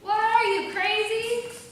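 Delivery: loud, high shout across the water. A narrator's reading. Man on the shore shouting to the woman in the river. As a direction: yell out to the stranger from the shore. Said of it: "he shouted".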